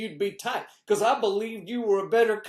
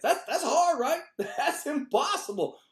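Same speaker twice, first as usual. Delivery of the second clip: ordinary delivery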